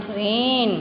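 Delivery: रेन with its vowel drawn out long; long vowel sound. Shown as a demonstration. Teacher showing children the long A sound, drawn-out vowel. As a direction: stretch the middle of रेन. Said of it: "then it produce long sound".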